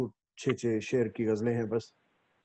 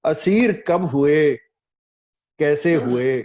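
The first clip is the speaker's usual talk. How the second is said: slow, louder verse with long dramatic pauses; mushaira recitation; 4 semitones higher, 7 dB louder, slower than usual. Acted. Poet puts questions in verse to the audience, declamatory recitation. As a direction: land each question separately, projecting to the room.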